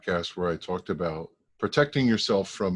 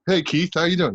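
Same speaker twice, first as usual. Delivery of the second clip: bright loud hey; cheerful mistaken greeting; 9 semitones higher, 6 dB louder than usual. Retold or imitated. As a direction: greet him eagerly by the wrong name. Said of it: "everybody calls me Keith".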